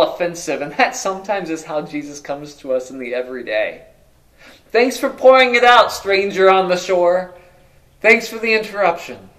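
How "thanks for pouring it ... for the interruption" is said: sarcastic thanks. Acted. Mock sarcastic thanks to stranger.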